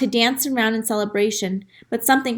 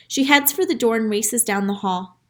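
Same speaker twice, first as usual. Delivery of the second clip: plain reading voice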